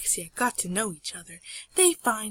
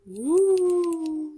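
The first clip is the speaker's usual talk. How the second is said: high, loud "woo" howl; imitated howl; 8 semitones higher, 6 dB louder than usual.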